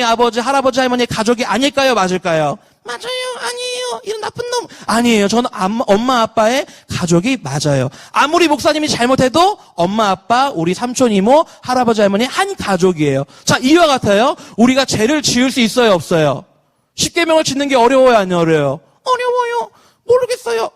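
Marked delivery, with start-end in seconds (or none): 2.8-4.7 very high, soft falsetto; squeaky answering voice. Acted. Pastor voicing replies to his own question, squeaky put-on voice. 19.0-20.7 squeaky answering voice. Acted. Pastor voicing replies to his own question, squeaky put-on voice.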